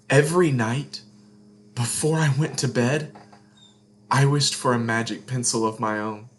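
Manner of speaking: gentle storybook read-aloud; expressive picture-book narration; reading to children. A narrator's reading. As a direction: tell it like a bedtime story to kids.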